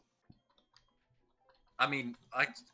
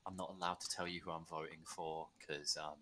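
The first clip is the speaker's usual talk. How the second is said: low, soft, rushed teacher; mock teacher mumble; 8 semitones lower, 10 dB quieter than usual. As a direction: mutter it like a cagey teacher. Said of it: "they had to be like".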